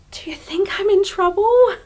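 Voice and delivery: high, anxious little question; worried inner voice. Acted. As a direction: act out nervous second thoughts. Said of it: "someone's internal voice being like".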